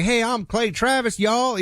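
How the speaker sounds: high-pitched mock Southern greeting; imitated Southern code-switch. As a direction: put on a Southern local's accent. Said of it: "You don't do the code switch thing".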